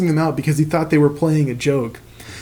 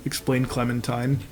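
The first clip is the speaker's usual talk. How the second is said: ordinary talk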